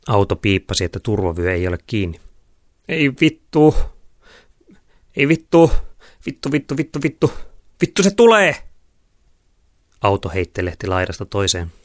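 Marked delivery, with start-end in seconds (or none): 2.9-8.5 high, rising panic, ending in a shout; panicked swearing. A narrator's reading. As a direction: curse over and over as danger closes in. Panicked girls flee in car. (paniikissa kiroilua)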